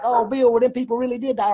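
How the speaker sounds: high mock voice whining his name; mocking whiny critic. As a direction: sneer at the critics by aping them high. Mocking critics who argue with him.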